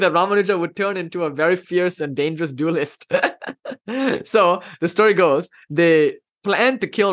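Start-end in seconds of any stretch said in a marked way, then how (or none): 3.1-4.2 brief amused laugh; short laugh. Real, not acted. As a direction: chuckle at the absurd plot. Laughs at the murder plot.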